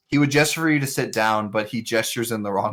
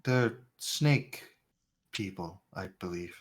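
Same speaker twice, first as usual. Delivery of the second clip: soft blind stranger; 3 semitones lower, 6 dB quieter than usual. Acted. NPC answers quietly.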